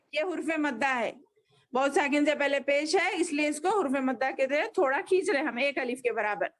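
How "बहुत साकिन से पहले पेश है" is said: usual speaking voice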